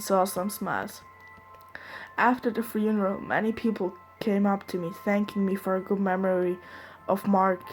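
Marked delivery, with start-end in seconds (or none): none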